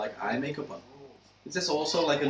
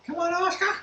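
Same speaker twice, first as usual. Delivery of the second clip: high, loud Cockney washerwoman holler; 4 dB louder than usual. Pretend. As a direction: yell it like an Oliver Twist washerwoman. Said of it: "washerwoman from, like, a Cockney".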